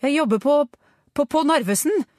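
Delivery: high, loud, halting reply; rattled stutter under interrogation; frightened stammer. A narrator's reading. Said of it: "stammet mannen".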